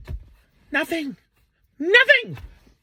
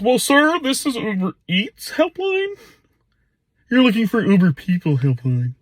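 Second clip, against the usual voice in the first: mock call-centre agent; 6 semitones lower, slower than usual. Mocking helpline agent.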